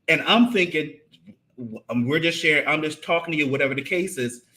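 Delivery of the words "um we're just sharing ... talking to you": ordinary talk, nothing marked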